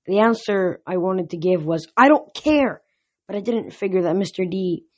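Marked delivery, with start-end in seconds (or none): 2.0-2.8 high, loud burst; exasperated outburst. A narrator's reading. Boy's wished-for retort to the god's story, exasperated. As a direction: snap it out impatiently.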